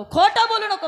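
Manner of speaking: खोटं बोलू नको shouted high and loud; angry shout. Acted. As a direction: snap at the boy for lying. Teacher scolds lying pupil.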